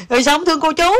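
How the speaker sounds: high-pitched, just out of laughter; real amusement lifting the voice high; amused. Real, not acted. Amused by child's blunt answer.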